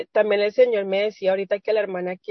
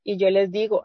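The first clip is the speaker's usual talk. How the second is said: usual speaking voice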